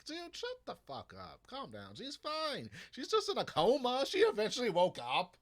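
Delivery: high, loud mock exasperation at Gambit; exasperated inner rant. Fan venting at Gambit, exasperated. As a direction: tell him off, fed up. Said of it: "I'm thinking".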